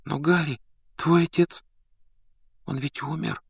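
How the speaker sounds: soft, high-pitched hesitant voice; said quietly. A narrator's reading. Hermione reminding Harry his father died, speaking quietly. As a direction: break it to him softly, haltingly. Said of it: "тихо произнесла она".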